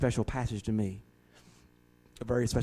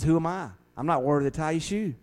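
louder, higher awed cry of unworthiness; humbled John the Baptist; 5 semitones higher, 6 dB louder than usual. Retold or imitated. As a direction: exclaim your unworthiness to the Lord.

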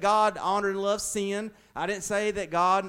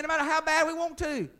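ordinary talk

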